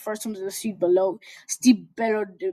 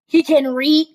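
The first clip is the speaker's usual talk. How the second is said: loud, higher sarcastic jab; 6 semitones higher, 10 dB louder than usual. Real, not acted. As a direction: say it as a teasing dig. Sarcastic aside.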